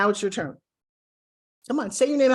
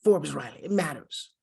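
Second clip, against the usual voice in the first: low, softened, weighty delivery; hushed low gravity; gravity and importance; 5 semitones lower, 4 dB quieter than usual. Shown as a demonstration. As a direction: say it like it truly matters. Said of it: "There's an importance to it".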